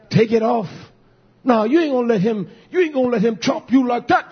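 high, loud, hyped-up taunting; egging on a fight. Retold or imitated. Preacher voicing kids egging on a schoolyard fight, high loud taunting.

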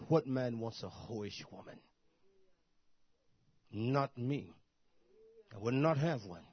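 normal talking voice

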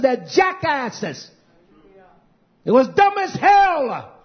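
preacher's loud contemptuous drag; scornful emphasis. Real, not acted. Preacher ranting.

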